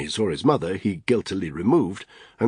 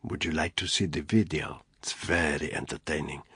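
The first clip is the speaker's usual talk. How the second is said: low, soft, cruel offer; cruel mockery; 3 semitones lower, 6 dB quieter than usual. Brother offering his sister the video, low and soft.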